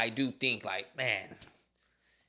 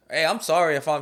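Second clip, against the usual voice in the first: ordinary talk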